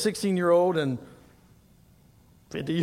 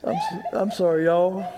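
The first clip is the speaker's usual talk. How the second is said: embarrassed laugh; 3 semitones lower than usual. Real, not acted. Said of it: "I'm pretty good at working through most things".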